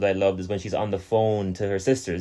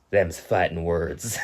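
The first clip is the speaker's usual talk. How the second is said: mock country drawl; 6 semitones lower, 5 dB quieter, much slower than usual. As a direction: drag it out like a country barfly. Quoting country bar drinker.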